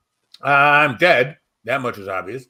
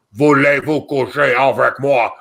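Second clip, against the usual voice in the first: mock French in a drunken German accent; imitated bad German-accented French; 3 dB louder than usual. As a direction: mangle the French like a tipsy German teacher. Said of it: "sounded like a drunken German with hiccups".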